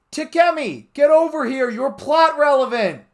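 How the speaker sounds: very high, loud yell; urgent hammed-up yell. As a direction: holler it across the room. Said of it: "I yelled".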